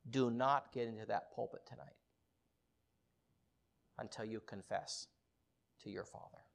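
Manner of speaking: low, soft, halting between phrases; grave quiet command. Retold or imitated. Holy Spirit forbidding him the pulpit, grave quiet command. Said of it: "God's Holy Spirit said".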